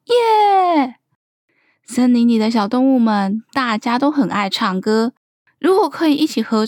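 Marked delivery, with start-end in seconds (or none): 0.1-1.0 high, long-drawn yay that slides down; gleeful high-pitched hooray; happy cheer. A narrator's reading. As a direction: cheer it out like delighted kids. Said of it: "都很高兴地说".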